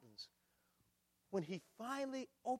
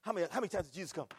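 ordinary talk

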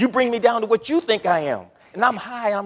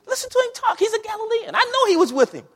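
put-on much higher young woman's voice, sure of herself; young lady accusing; 13 semitones higher than usual. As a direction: squeal it out like the girl catching Peter. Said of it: "She knew, she goes".

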